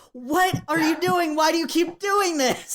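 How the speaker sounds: high-pitched, slowed-down cry of disbelief; exasperated disbelief. Retold or imitated. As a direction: throw up your hands at her again in a high voice. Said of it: "they're both like".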